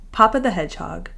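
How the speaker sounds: plain reading voice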